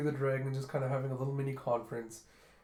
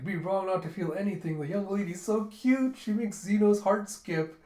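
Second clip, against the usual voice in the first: high, louder, smitten gush; 6 semitones higher, 5 dB louder than usual. Acted. Zeno praising the cute young lady, gushing. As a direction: gush adoringly, slowing at the end.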